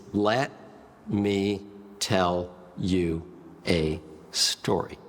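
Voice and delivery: "Let", "me", "tell", "you", "a story" slowly set apart; dramatic word-by-word pauses. Shown as a demonstration. Showing six most powerful words.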